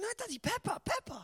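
put-on high, whiny little boy refusing; small child whining. Toddler son refusing his dad's cuddle, high whine. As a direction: squeak it out like a toddler glued to the telly. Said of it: "He's going".

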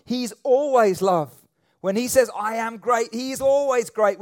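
loud, high, rising sermon voice; fervent preacher hammering the point; full-force preaching. Acted. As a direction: preach it out to the whole room.

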